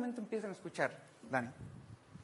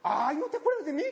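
offended friend; 4 semitones higher, 5 dB louder than usual. Retold or imitated. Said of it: "pero ¿quién eres?".

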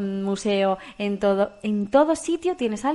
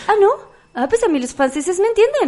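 a hint of a breezy, unbothered applicant, higher and louder; cheeky applicant; 10 semitones higher, 7 dB louder than usual. Applicant insisting the French understand her. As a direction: shrug it off with a laugh. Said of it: "Y le dice".

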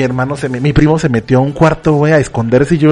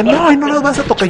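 much higher, protective mock mom; mom defending high; 10 semitones higher than usual. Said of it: "pero sí de". (mamá defendiendo con voz aguda)

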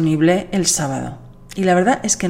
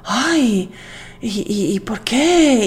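higher, mildly surprised "why?"; mock surprise; 4 semitones higher, slower than usual. People surprised she answers honestly, mild mock surprise. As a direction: act a little puzzled at her honesty. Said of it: "me miran con sorpresa".

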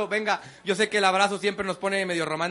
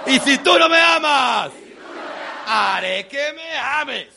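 higher, loud, slow shouted affirmations; 7 semitones higher, 5 dB louder, much slower than usual. Shown as a demonstration. Speaker shouts self-love lines for the crowd to echo. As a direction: bellow each phrase, drawn out. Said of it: "repitan mis movimientos y mi tono de voz".

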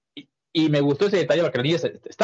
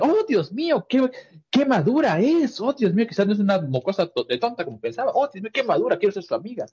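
high, breathless gushing schoolgirl; high impressed girl voice gushing about a classmate; 8 semitones higher than usual. Retold or imitated. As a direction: gush with admiration. Voicing impressed girl character.

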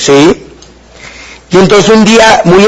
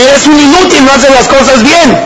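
higher-pitched scornful scolding; mock insult; 7 semitones higher than usual. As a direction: throw the insult at him like a harsh boss. Boss insults the listener. (imitando a un jefe que insulta con desprecio)

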